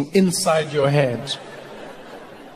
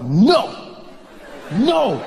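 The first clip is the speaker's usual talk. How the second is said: firm refusal; 7 semitones higher than usual. Acted. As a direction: order your own body to stop. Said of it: "I control it".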